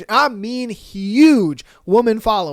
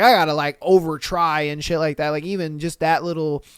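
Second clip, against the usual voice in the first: normal talking voice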